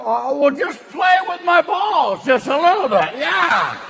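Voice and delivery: loud folksy aw-shucks voice; Jimmy Stewart. Retold or imitated. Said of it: "Did Jimmy Stewart basically go".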